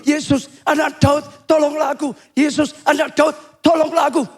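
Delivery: high, insistent call for help; repeated calling out. Acted. Blind Bartimeus keeps begging passing Jesus for help, calling out. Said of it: "Dia teriak".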